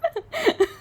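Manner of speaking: sudden high-pitched laugh; startled high laugh. Real, not acted. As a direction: laugh in a jolt of surprise.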